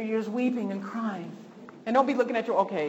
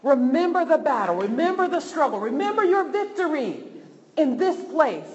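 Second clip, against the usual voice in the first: preaching; 6 semitones higher, 5 dB louder than usual. Acted. Preacher's opening call to remember.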